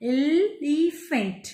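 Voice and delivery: एलिफेंट slowly as el, ee, fent; stretched एलिफेंट, piece by piece; three-part reading. Shown as a demonstration. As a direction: sound out एलिफेंट in three chunks. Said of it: "इस तरीके से हम पढ़ते हैं".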